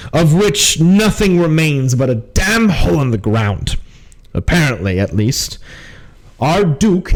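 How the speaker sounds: weary officer's briefing, deliberate pauses; brief the party as a troubled captain; grave captain. Acted. Captain explains the refugee crisis to party, grave.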